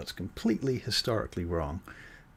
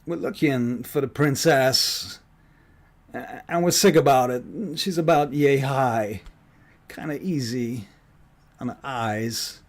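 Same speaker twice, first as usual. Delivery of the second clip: Christopher Walken impression; 3 semitones higher, 8 dB louder than usual. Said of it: "He's like".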